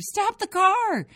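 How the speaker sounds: very high, loud, slow scream; panicked scream. A narrator's reading. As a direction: scream in panic as the car swerves. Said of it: "I screamed".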